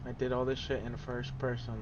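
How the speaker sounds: usual speaking voice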